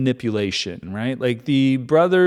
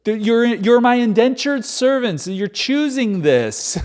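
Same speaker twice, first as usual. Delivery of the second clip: mock Joseph correcting; 6 semitones higher than usual. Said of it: "He goes".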